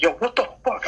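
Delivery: high, outraged yelp; mock road rage. Driver retelling yelling at a car after running a red light. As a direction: squeal it at the other driver.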